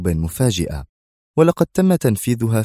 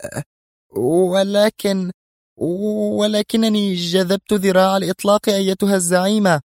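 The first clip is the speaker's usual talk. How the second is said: trembling fear; 12 semitones higher than usual. A narrator's reading. Shaken woman protests to her leader, trembling. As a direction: stammer a high-pitched, fearful excuse. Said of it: "وهي تقول مرتجفة".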